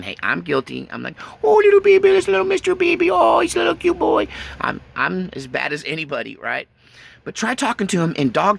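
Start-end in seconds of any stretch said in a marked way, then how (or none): 1.4-4.3 put-on squeaky, much higher and louder baby talk; cutesy dog voice. Owner gushing to a pet dog, squeaky baby talk. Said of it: "squeaky, cutesy voice that you normally talk to your dog in".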